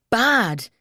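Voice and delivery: sudden loud high shout; yell. A narrator's reading. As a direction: bark the word out in outrage. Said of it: "he yells".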